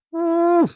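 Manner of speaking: high, drawn-out wolf howl. Pretend. Speaker calls New York City to his wolf pack, howling.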